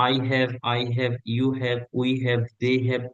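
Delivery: slow, spaced-out drill of have forms. Shown as a demonstration. Teacher slowly drilling I have, you have, we have. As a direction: lay out each pronoun and have slowly. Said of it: "drilling subject forms".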